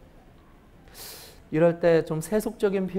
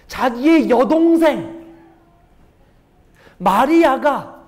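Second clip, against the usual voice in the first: slow and louder, each word held; slow dramatic reveal; 4 semitones higher, 6 dB louder, much slower than usual. Acted. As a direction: draw out 마리아가 slowly for suspense.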